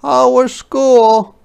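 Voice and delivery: high, loud sing-song mom greeting; cheerful mother. Acted. Mama greets Ruthie home, high and cheery. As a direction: welcome her home brightly. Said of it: "said Mama".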